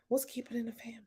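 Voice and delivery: whisper. Real, not acted. Reading a comment aloud quietly.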